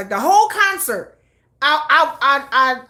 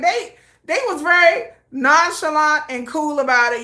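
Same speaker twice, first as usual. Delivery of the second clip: ordinary talk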